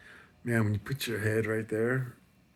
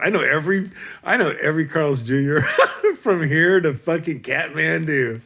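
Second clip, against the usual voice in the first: loud, higher, giddy boasting; excited; 7 semitones higher, 10 dB louder than usual. Real, not acted. Comic raving loudly about burger joints.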